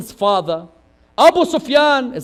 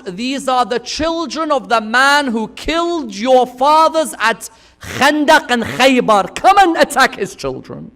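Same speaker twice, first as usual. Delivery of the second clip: raised, slowed mock call of the enemy soldiers; retold call to attack; 5 semitones higher, 7 dB louder than usual. Preacher voicing enemies calling out to attack. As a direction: call out the taunt, louder and slower.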